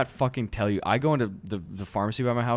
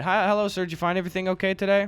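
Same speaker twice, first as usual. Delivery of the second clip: quick, higher customer service script; tired bored cashier; 7 semitones higher, faster than usual. Pretend. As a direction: rattle off the cashier greeting. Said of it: "you be the Rite Aid employee".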